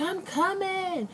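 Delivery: high, drawn-out imitation with attitude; slow, high-pitched sassy retort; mock attitude. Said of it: "with an attitude".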